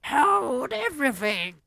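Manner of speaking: very high, loud witch screech; shrieking. Acted. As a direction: screech to freeze the room. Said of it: "Her shrieking voice".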